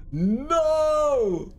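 high, wailed NO; high protesting no. Real, not acted. Player refusing defeat, high wailed NO. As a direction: swoop NO up high, then let it fall.

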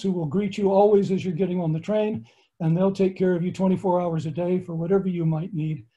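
usual speaking voice

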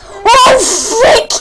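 high, loud startled exclamation; startled outburst. Real, not acted. Player startled by danger in the game, alarmed. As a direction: blurt it out in sudden alarm.

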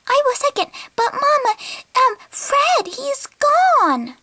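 put-on high little kid voice; child voice. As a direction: speak like a small child.

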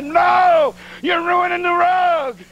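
high, slow yelled tease; mock shout. Herman teasing the preacher about the bear, slow high shout. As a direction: shout the joke back across the hill.

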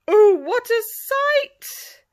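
high, loud, slow awe; amazed. A narrator's reading. Storyteller marvelling at the sight for the children. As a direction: gasp out the wonder. Pirates see treasure.

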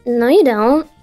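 ordinary talk, nothing marked

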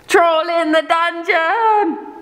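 high, slow panicked cry; panicked shout. Acted. Panicked alarm call.

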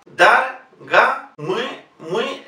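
Dar, ga, muy, muy, slow teaching syllables. Shown as a demonstration. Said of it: "este fonema es una mezcla".

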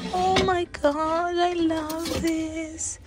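high, drawn-out swoon; awed delight. Real, not acted. Shopper finds something she loves, swooning with delight. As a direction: slowly gush in a high voice, overcome.

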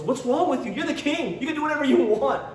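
high-pitched exasperated mock scolding; exasperated Jezebel. Voicing Jezebel scolding the sulking king.

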